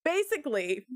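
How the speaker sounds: ordinary delivery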